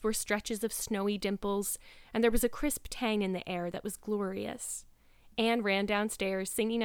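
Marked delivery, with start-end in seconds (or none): none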